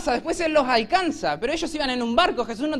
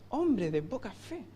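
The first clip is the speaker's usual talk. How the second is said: much softer, slow, sneering "Hombre de poca fe" with a rising end; mocking old churchgoer; 2 semitones lower, 10 dB quieter, slower than usual. Un cristiano de años. (imitación burlona de un cristiano veterano, suave y lenta)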